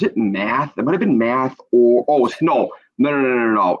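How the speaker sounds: ordinary talk, nothing marked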